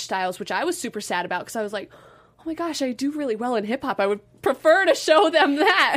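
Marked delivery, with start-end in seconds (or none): 2.4-6.0 higher, building to louder; pleading to show off hip hop; eager inner voice, rising; eager retold plea.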